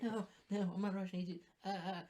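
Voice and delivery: high-pitched comic protest; mock denial. Mocking an accused agent to a friend, mock denial. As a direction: put on a high little voice of denial. Acted denial by mocked politician.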